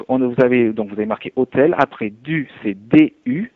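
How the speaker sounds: ordinary talk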